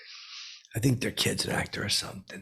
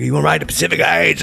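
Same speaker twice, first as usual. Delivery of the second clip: loud, high call from a passing car; 7 semitones higher, 10 dB louder, faster than usual. Retold or imitated. To Mike Keaton jogging by, a loud teasing call from the car. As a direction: shout it out the car window.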